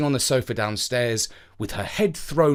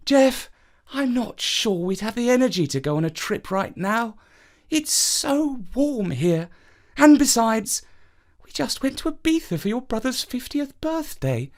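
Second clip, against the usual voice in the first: Mum declining; 10 semitones higher than usual. A narrator's reading. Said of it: "Mum said".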